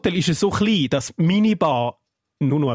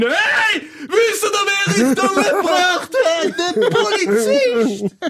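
high, loud, slow gleeful yelling; drunken shout; 6 dB louder, much slower than usual. Retold or imitated. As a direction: shout it across the campsite. Retold drunk shout to campers. (lauter betrunkener Ruf)

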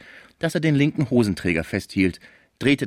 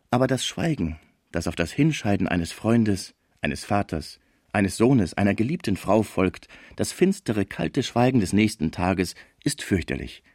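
ordinary delivery, nothing marked

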